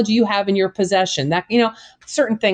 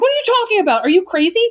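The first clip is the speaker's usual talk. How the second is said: higher, indignant mock outrage; a retold indignant woman, hammed up; incredulous retort; 10 semitones higher, much faster than usual. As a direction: snap back like she can't believe the question.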